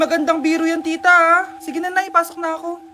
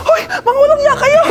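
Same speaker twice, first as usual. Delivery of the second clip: high, loud, laughing scold; stuck teacher shouting down at her pupils; outraged yell; 9 semitones higher, 6 dB louder than usual. Acted. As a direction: shriek it half laughing, half crying. Kids stick teacher to wall.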